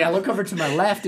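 normal talking voice

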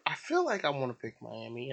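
ordinary talk, nothing marked

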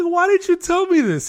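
incredulous outcry. Retold or imitated. To the neighbor who hid it, a high incredulous outcry. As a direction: cry out in disbelief.

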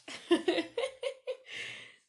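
high, breathy burst of giggles; real laughter. Real, not acted. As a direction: crack up at your own accent. Cracks up at own accent.

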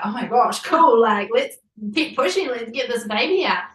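loud, bright cheer; rally to keep going; excited. Retold or imitated. Couple cheering each other on during birth, excited. Both feel the head, excited.

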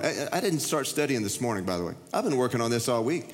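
ordinary talk, nothing marked

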